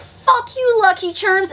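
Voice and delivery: high mock kid shout; cocky kid taunt. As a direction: spit it like a bratty kid. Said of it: "And he's like".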